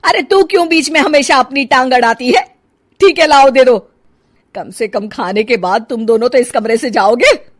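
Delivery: loud, high annoyed scolding; angry scolding. Acted. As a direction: scold her for interfering. Mother scolds daughter-in-law.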